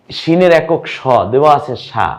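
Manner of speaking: usual speaking voice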